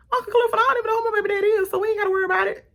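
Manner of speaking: very high, rushed character voice; high-pitched, fast comic imitation; Jupiter. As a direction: rattle it off in a squeaky put-on voice. Voicing Jupiter's reply, very high and fast.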